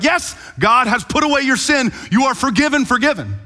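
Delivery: high, loud declaration of absolution; mock pronouncement. Preacher mimicking Nathan pronouncing absolution, mock pronouncement. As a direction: proclaim it with incredulous force. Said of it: "Nathan, to be like".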